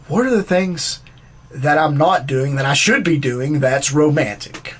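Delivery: loud, pressing monologue delivery; exasperated. Acted. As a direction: demand an answer, acting exasperated. Acted boyfriend retells his demand.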